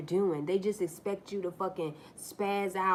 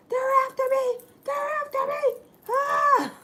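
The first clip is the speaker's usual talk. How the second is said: much higher, louder hammed-up panicked yelling; mock freaking out; 9 dB louder than usual. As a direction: play a paranoid man screaming that they're chasing him. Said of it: "run down the road freaking out".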